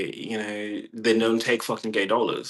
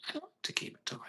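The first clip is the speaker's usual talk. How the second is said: breathy joking whisper, high and hushed; 16 dB quieter, much faster than usual. Real, not acted. Teasing aside to the viewers, high hushed whisper. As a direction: confide it under your breath.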